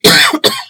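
sudden sharp cough; coughing on dust. Real, not acted. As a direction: cough hard mid-thought. Cough mid-sentence after dust.

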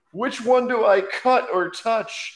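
high mock child voice; hammed-up high kid question; imitated puzzled kid. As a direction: wonder aloud as a dithering kid, pitched high. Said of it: "a kid looking at a bunch of wires".

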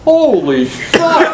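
loud, high, slow shout of astonishment; amazed shout. Retold or imitated. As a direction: blurt it out stunned. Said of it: "and then you hear".

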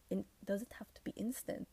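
unvoiced quick whispered question. Shown as a demonstration. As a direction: whisper it to yourself, testing. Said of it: "Is that good English?".

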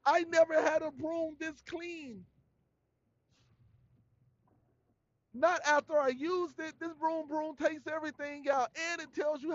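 high, eager customer hype; hyped review. Retold or imitated. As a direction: rave about the broom broom to the brand. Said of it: "Then you tell them".

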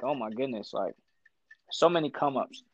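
ordinary delivery

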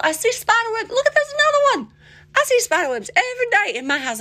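high-pitched, worked-up complaint; worked up and squealing; flustered exclaiming. Real, not acted. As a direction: voice leaping up in exasperated disbelief. Flustered about spider webs.

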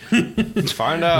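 slow drawn-out TV announcer; announcer parody. Pretend. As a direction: drag it out like a show narrator. TV narrator parody.

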